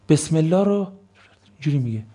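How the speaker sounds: ordinary talk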